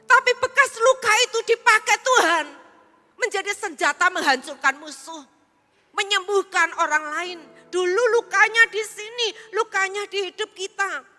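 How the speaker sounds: loud, high preaching over music; rising pulpit shout; full-force preaching. Acted. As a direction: proclaim it with power to the congregation.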